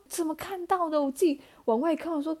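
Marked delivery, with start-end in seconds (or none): 0.1-1.0 higher, startled inner question; surprised. Retold or imitated. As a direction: squeak it out in disbelief. Shocked inner voice.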